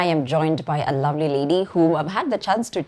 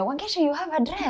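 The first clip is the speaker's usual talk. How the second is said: laughing mock viewer; 10 semitones higher, 4 dB quieter than usual. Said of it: "watu wataona hii episode waseme".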